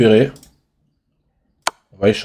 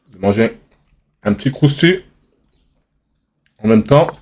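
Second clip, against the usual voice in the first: chewing while talking; mouth full. Real, not acted. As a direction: talk through a mouthful of crisps. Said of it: "Désolé si je parle la bouche pleine". (la bouche pleine)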